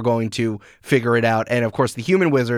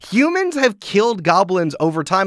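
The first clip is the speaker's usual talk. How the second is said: normal talking voice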